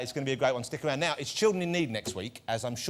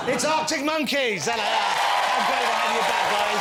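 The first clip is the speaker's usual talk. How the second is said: showman guest introductions; 6 semitones higher, 5 dB louder than usual. Acted. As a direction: announce each guest like a big-night showman. Host introduces guests, shouting names.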